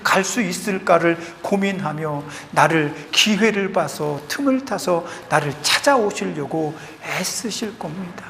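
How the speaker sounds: ordinary talk, nothing marked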